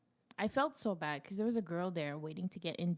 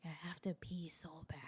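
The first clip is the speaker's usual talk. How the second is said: urgent sleepy whisper; 4 semitones lower, 14 dB quieter than usual. Retold or imitated. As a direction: whisper it, barely awake and desperate. Waking up needing to pee.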